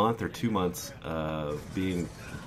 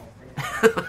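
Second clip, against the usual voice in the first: high, breathy burst of laughter; disbelieving laugh; 5 dB louder than usual. Real, not acted. Son laughing at his mother's claim, high burst of laughter. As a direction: laugh at how absurd it was.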